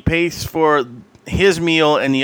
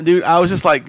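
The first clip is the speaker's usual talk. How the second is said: ordinary talk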